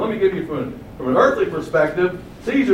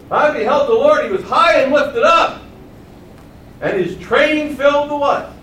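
high, loud preacher declamation; raised, ringing recitation; proclaiming scripture; 9 semitones higher, 5 dB louder than usual. Acted. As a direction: declaim Isaiah's vision with force. Preacher declaims Isaiah's vision.

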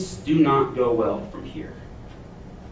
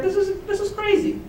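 amazed. Real, not acted. Amazed exclamation.

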